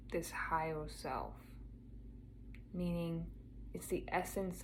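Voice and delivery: soft meditation voice with long pauses; guided meditation. Acted. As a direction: gently guide a listener inward, unhurried and quiet.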